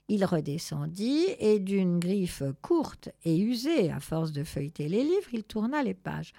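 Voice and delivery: usual speaking voice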